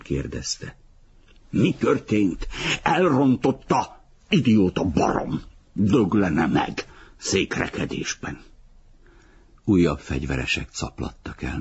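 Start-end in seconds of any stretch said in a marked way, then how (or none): 1.5-8.4 exasperated cursing, high then sinking; angry outburst. A narrator's reading. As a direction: throw the question back and curse, pitch leaping. Angry retort to a question. (dühös, elkeseredett szitkozódás)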